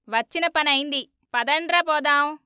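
loud, rallying call. A narrator's reading. Disciples leaving with the pot, loud call to go. Disciples calling each other to leave.

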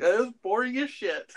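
laughing while calling it boring. Real, not acted. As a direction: laugh through the put-down. Laughing verdict on the film.

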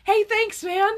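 company gushing thanks to a paying customer; mock grateful company. Mock corporate thank-you.

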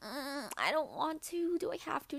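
a hint of whining; whiny reluctant sister. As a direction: plead like a reluctant kid sister. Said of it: "she was like".

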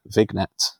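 "Vignette" as vig-net, hard g sounded; deliberate mispronunciation. Pretend. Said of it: "consonant heavy".

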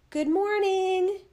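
slow, high call into the room. A narrator's reading. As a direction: call out a drawn-out greeting. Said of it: "Then she called out".

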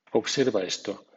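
normal talking voice

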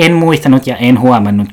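ordinary talk

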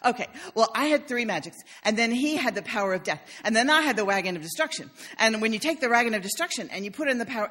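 put-on loud, rushing kid going on and on; breathless run-on kid chatter, mimicked; rambling child. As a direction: imitate an excited child reeling off card-game details. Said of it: "just go on and on".

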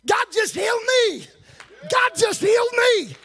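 high, loud shout of amazement; joyful shout. Retold or imitated. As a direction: cry out that you've been healed. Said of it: "the first time visitor said".

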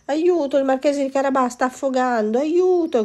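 high, loud cries for help; desperate shout. Acted. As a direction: yell for help as if your master is drowning. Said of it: "gridò il gatto disperato".

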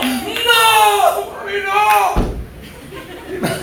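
high drawn out whine; high slow whining no; retold pleading. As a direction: stretch the no like a begging child. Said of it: "Yo estaba gritando".

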